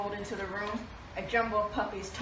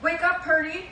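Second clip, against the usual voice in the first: bright, loud call, higher pitch; cheerful wake-up call; 8 semitones higher, 7 dB louder than usual. Acted. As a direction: call her awake, playful storybook voice. Reading-aloud character wakes Purdy.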